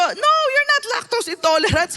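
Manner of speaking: high, loud teasing no; playfully brushing off the claim; mock-incredulous reply. Grandma answers grandchild.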